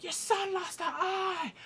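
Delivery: high, drawn-out mock wail; bickering outcry. Parents bickering in the Great Hall, high outcry. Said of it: "just bickering one each other".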